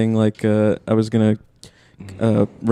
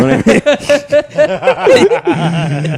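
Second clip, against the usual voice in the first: laughing through the words; can't hold the laugh in; slowed, laughing question; 13 semitones higher, 3 dB louder, much slower than usual. Real, not acted. Breaking into laughter mid-story.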